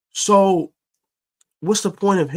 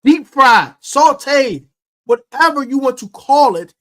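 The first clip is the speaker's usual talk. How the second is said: heated rant; 8 semitones higher than usual. Real, not acted. Host ranting to fans about the Forbes criticism, heated and climbing. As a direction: let the frustration boil over. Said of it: "I'm gonna go on a mini rant".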